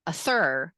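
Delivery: "a thir" for "other", stressed on the second syllable; wrong-syllable stress. Shown as a demonstration. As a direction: say uh-THER, weight on the end. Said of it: "if you said".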